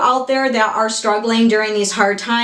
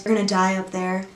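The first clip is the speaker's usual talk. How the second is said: softer, docile movie-line imitation; calm, docile; 3 semitones lower, 6 dB quieter than usual. Vlogger quoting Reagan from the movie, calm docile voice. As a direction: say it calmly and quietly, imitating the character. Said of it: "in a very calm, you know, docile voice".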